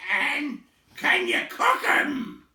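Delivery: high, loud, slow troll question; hungry troll. Acted. Tom asking if hobbits can be cooked, greedy. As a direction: Tom only thinking of food.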